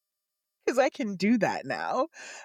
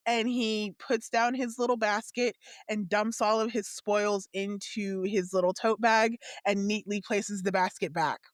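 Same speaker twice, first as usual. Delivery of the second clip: ordinary talk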